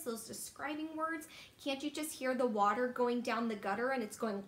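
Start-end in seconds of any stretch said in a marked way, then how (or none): none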